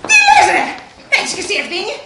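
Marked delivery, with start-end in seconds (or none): 0.1-0.6 high, loud outraged retort; indignant. Acted. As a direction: explode at the accusation. Indignant at being blamed. (αγανακτισμένα, δυνατά και ψηλά)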